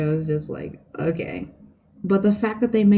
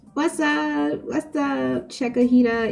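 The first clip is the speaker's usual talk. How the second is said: high greeting; 7 semitones higher, slower than usual. Real, not acted. Greeting sister in chat.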